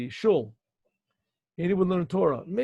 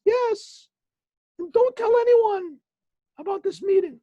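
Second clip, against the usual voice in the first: fake angel voice; 6 dB louder than usual. Boy as angel again.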